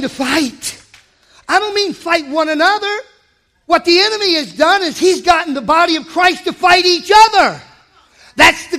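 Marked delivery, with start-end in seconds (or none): none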